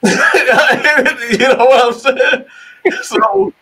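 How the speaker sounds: loud, high, real laughter through the words; words stretched out by laughing. Real, not acted. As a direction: crack up while talking. Cracks up at his own story.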